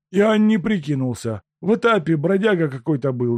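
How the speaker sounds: high, loud plaintive peasant; a downtrodden peasant pleading, high; humble peasant. Acted. Peasant tells his story.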